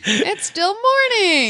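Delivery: high, slow sleepy cartoon voice; drowsy squeaky mouse; Minnie Mouse voice. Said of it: "just do the Minnie voice".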